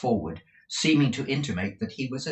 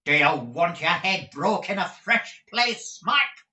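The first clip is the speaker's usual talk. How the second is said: higher and louder, threatening shout; shouted demand; 9 semitones higher, 6 dB louder than usual. Acted. Mrs Squeers shouting a threat at the missing Smike. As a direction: bawl the threat up the stairs. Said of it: "demanded his amiable lady in the same way".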